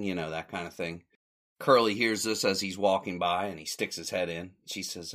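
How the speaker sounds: ordinary talk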